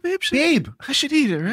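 high, sing-song giving in; high mock sweet. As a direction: pretend to cave in sweetly. Acting out giving in.

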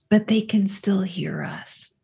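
hushed unvoiced whisper. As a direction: breathe it out. Warning about the toads nearby.

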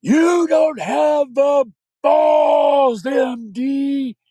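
high, loud, slow screaming taunt; defiant scream. Retold or imitated. Mother defying the father with a gun, high and loud. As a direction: scream the dare right in his face. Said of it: "screaming in my dad's face".

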